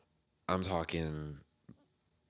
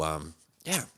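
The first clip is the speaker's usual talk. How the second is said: ordinary talk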